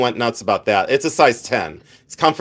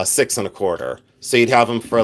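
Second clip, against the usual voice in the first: ordinary delivery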